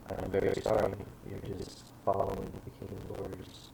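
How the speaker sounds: low, subdued official; expressionless inquisitor. Acted. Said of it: "his face is just expressionless".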